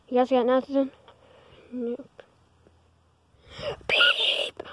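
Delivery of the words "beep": high sung beep; censor beep. Pretend. Kids faking a censor bleep for dad's swearing, high beep. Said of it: "we're just saying the word beep".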